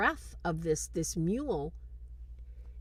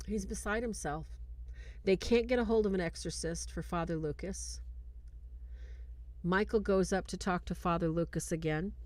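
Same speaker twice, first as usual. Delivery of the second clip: ordinary delivery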